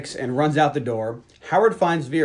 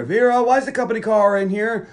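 higher, louder call across the house; 8 semitones higher, 5 dB louder than usual. Retold or imitated. Husband calling to Vera from outside, loud call. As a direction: call her name from the driveway.

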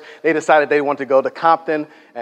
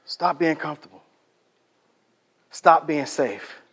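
hushed repetition; 7 dB quieter, slower than usual. Real, not acted. Preacher repeats the charge softly.

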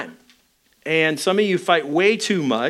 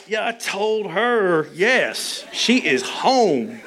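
slow, drawn-out self-satisfied gloat; mock gloating; 4 semitones higher, much slower than usual. Husband walking out after the fight, hammed-up gloating. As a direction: savor each word in mock triumph.

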